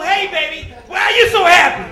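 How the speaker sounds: high, loud shouted demand; mock angry wife. Wife challenging her husband as he comes home, mock angry shout. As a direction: pounce on him with hammed-up outrage.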